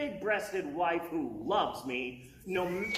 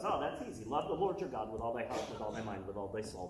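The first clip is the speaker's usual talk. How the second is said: lower, fast and easy, a hint of a calm teacher; lightly voiced Jesus; 8 semitones lower, 6 dB quieter, faster than usual. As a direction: recite it offhand like it's obvious. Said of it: "And Jesus goes".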